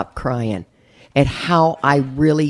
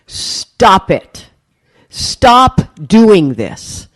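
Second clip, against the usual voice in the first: higher, louder, drawn-out "stop"; retold forceful plea, slow and raised; emphatic command; 5 semitones higher, 6 dB louder, much slower than usual. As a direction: firmly halt someone mid-apology, stretching each stop. Stepmom cuts off her tearful apology.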